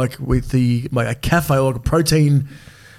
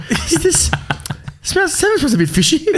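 mock fussy diner; 13 semitones higher than usual. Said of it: "You go".

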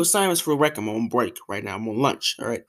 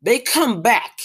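ordinary delivery

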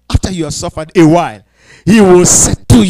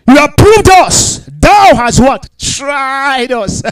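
preached scripture; 7 semitones higher, 5 dB louder, much slower than usual. Acted.

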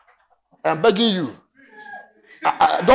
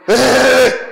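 loud admiring calls of hey; mock admiring hey; 6 dB louder than usual. Crowd admiring Louis, loud mock hey. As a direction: call out as if dazzled. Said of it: "you look at him and say".

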